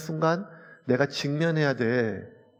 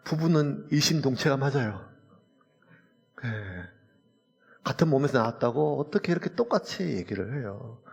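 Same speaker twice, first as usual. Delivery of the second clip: ordinary talk, nothing marked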